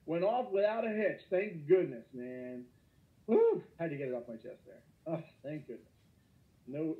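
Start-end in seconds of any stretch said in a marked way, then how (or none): 3.3-3.6 high loud whoop of joy; excited whoop. Real, not acted. Teacher cheering about the inauguration, high joyful whoop. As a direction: let out a celebratory whoop. Giddy about new president.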